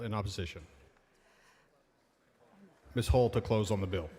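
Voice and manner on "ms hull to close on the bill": ordinary delivery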